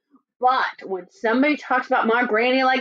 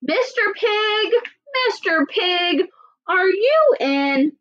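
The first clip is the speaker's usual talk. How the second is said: slow, higher sing-song call; 8 semitones higher, slower than usual. Acted. Wolf calls to the third pig, slow and sing-song. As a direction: coax the brick-house pig out. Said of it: "I called".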